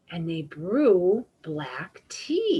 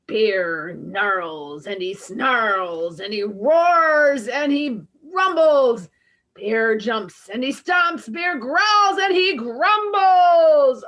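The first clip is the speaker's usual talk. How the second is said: dramatic growling narration; 2 semitones higher, 7 dB louder than usual. A narrator's reading. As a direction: growl through the words like a bear. Bear wakes up roaring.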